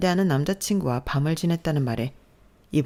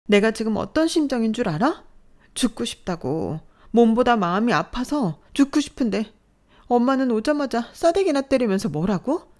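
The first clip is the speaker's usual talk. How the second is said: loud, higher, desperate protest; anguished anger; 6 semitones higher, 4 dB louder than usual. Acted. Daughter confronting mother, anguished anger. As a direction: pour out the hurt. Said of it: "부들부들 떨며 화를 내는 모습".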